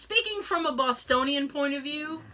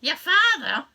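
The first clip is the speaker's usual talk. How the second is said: louder, hammed-up Boston mother; Boston mom with dropped R's, pitched up; Boston mom accent; 5 semitones higher, 5 dB louder than usual. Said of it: "Mom, teach me the accent".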